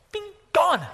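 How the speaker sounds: high ping, then loud astonished cry; amazed vanishing. Acted. Preacher shows congregation Philip suddenly vanishing, amazed.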